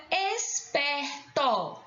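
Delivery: "esperto" slowly, syllable by syllable; split syllables. Shown as a demonstration. Teacher asking for the tonic syllable, "esperto" split up. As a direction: sound out "esperto" in separate syllables. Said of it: "Qual é a sílaba tônica?".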